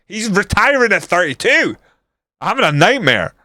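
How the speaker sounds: high-pitched exasperated disbelief; real dismay. Real, not acted. Manager learning his player retires at thirty-two, exasperated disbelief.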